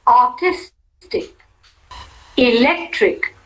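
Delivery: "Artistic", "Electrical", "Political" stressed on the syllable just before -ic or -ical. Shown as a demonstration. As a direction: drill each word, leaning on the syllable before the suffix. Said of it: "primary accent on the syllable immediately preceding the suffix".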